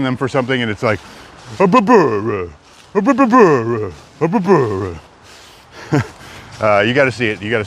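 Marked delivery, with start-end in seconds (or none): 1.6-6.0 put-on high, barky husky with an Italian accent; husky's Italian accent. Streamer mimics a viral husky begging its owner, barking in an Italian accent. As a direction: howl "bah" out like an Italian dog, pitch jumping up then sliding down. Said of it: "like, a perfect Italian accent".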